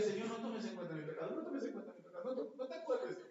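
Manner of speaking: quick, soft ashamed prayer; hushed guilty plea. Retold or imitated. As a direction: mumble it as if ashamed. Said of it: "algunos dirían". (súplica avergonzada y baja)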